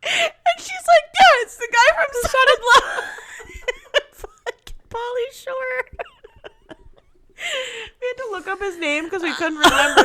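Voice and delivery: high, squeaky words breaking into laughter; helpless with laughter; laughing through it. Real, not acted. Cracking up at the story's punchline.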